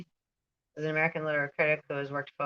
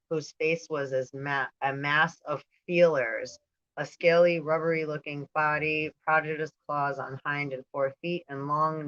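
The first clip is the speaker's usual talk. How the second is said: usual speaking voice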